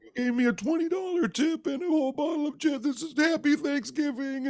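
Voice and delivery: high, choked-up grateful voice; mock teary driver overwhelmed; high, near-crying gratitude; tearful thanks. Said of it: "this guy was, like, crying".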